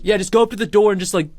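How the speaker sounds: high, loud, fast hype; hyped instructions. Retold or imitated. Friends coaching Casey Fry, fast hype. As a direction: rush out the plan, pumped. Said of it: "they're like".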